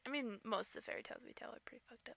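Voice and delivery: whisper. Real, not acted. Sudden unvoiced aside.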